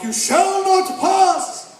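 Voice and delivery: huge, slow, high wizard's bellow; Gandalf. Retold or imitated. Wizard on the bridge defies Balrog, booming slow shout. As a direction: roar it slowly, dragging out every word. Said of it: "we hired an Englishman to play Gandalf".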